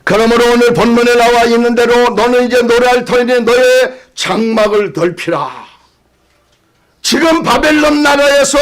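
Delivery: high-pitched, pressing proclamation; fervent preaching. Real, not acted.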